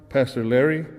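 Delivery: normal talking voice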